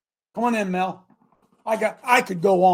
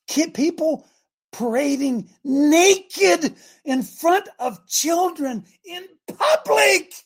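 slow, high, mounting indignation; outraged shout; 10 semitones higher, 6 dB louder, much slower than usual. Real, not acted. Preacher railing at a parade before children, outraged shouting. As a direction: build to a loud, high, stretched-out cry.

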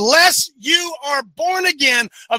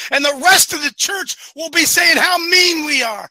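fiery preaching; 4 semitones higher than usual. Acted. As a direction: hammer each point home from the pulpit. Preaching at full force.

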